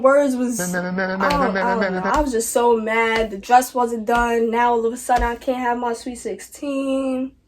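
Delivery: normal talking voice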